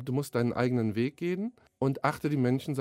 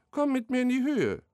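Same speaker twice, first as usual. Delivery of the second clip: much higher, put-on little butterfly voice; fluttery butterfly; 11 semitones higher than usual. Butterfly mocks the wet beaver, high squeaky put-on voice. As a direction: squeak it out like a prissy tiny butterfly.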